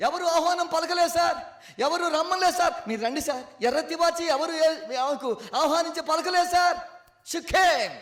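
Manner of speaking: high, loud pulpit preaching; fervent sermon climb, loud and high; full force preaching. Acted. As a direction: drive it home to the congregation.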